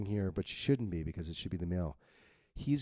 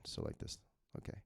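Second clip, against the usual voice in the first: whispered aside; 11 dB quieter than usual. Real, not acted. Aside while editing.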